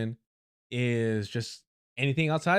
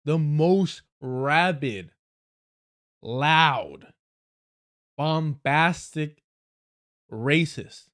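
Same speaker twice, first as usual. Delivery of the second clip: each word slow and louder; deliberate slow punch list; slow emphatic list; 4 dB louder, much slower than usual. Shown as a demonstration. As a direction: lean hard on rabid, loud, bombastic. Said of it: "stress on each word".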